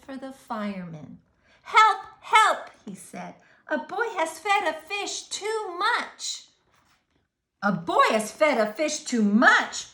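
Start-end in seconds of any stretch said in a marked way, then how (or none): 1.6-2.7 high, loud cry for help; urgent radio call. A narrator's reading. Policeman calls firemen on radio. 3.7-6.5 high, urgent radio report; policeman on radio. A narrator's reading. 7.6-9.9 fireman repeating. A narrator's reading. Fireman repeats reply on radio.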